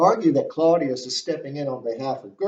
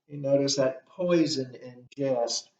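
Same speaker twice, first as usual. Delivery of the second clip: ordinary delivery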